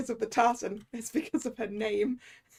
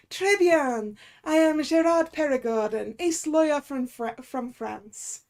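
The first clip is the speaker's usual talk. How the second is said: a hammed-up, bad French accent, a bit louder; horrendous French accent; 5 dB louder than usual. Lawyer introducing himself at the hotel desk, in a horrendous French accent. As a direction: lay on a cheesy French accent for the ace lawyer. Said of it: "I've broken out the, uh, horrendous accent".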